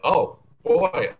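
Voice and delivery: ordinary talk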